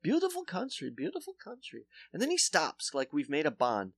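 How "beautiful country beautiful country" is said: put-on higher, trailing-off Polish accent. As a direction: dentist bonding over South Dakota in accent. Dentist voiced.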